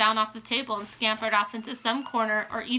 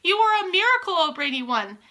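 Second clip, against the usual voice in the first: high, loud, gushing praise; exultant acclaim, pitched way up; jubilant cheering; 8 semitones higher, 6 dB louder than usual. A narrator's reading. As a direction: cheer like an adoring crowd. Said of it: "yelled the audience".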